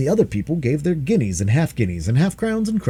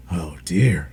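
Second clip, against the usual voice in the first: breathy whispered oh dear; whispered dragon; 7 semitones lower than usual. A narrator's reading. Dragon whispers in dismay at the screaming baby. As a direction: sigh it under the breath. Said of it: "said the dragon".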